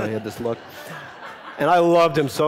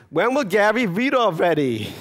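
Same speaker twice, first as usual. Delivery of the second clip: put-on impatient kids calling; 8 semitones higher, 4 dB louder than usual.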